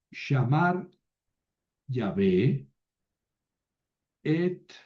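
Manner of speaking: shamar Yahvé et habrit read slowly; slow model reading. Shown as a demonstration. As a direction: read the Hebrew slowly, word by word. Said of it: "también conocemos este verbo". (lectura lenta del hebreo)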